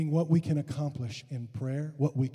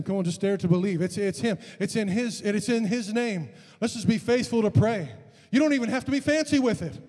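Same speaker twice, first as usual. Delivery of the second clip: rising, louder preacher's urging; rally the congregation to pray; climbing revival exhortation; urgent exhortation; 7 semitones higher, 5 dB louder than usual. Real, not acted.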